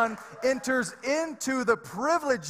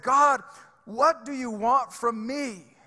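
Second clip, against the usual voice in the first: re-enacted anguished cry, raised and drawn out; retold cry to God; 4 semitones higher, slower than usual. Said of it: "I cried that out in the coffee shop".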